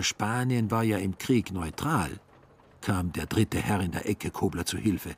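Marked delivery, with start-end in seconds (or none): none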